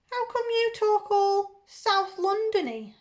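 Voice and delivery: high, slow put-on regional English accent. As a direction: ask in a teasing, amateur regional accent. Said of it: "I apologise now for my accents".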